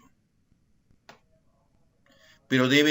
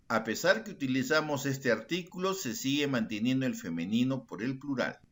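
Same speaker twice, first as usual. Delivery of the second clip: usual speaking voice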